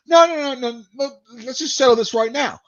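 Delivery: high-pitched, worked-up imitation of a Brit; mock an indignant Brit cutting someone off; pissy British guy. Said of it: "gets pissy with me".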